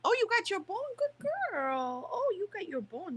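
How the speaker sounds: high sing-song praise for the puppy; pet-talk praise. Real, not acted. Owner praising puppy chewing her bone, in high cooing pet voice.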